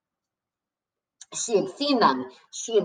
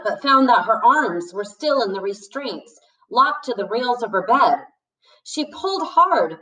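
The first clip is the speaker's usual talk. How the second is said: ordinary delivery, nothing marked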